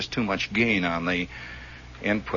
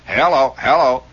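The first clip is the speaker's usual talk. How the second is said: loud, insistent radio test calls; loud mic test; 6 semitones higher, 9 dB louder than usual. Retold or imitated. Ham testing his new transmitter, calling loudly. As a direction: punch out each call into the mic.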